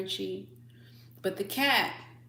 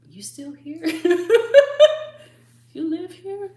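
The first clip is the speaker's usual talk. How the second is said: high, unimpressed cat with laughter; snooty cat voice; 9 semitones higher, 12 dB louder than usual. Retold or imitated. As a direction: aloof cat questioning the owner. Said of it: "she was just like".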